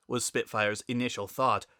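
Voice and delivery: usual speaking voice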